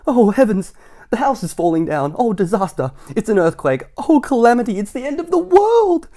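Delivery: high, loud, hammed-up panic; panicked wailing. Mister Jelly convinced his house is collapsing, wailing. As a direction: wail like the sky is falling. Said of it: "he cried".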